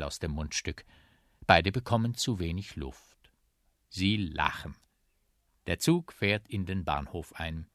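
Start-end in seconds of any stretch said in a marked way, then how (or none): none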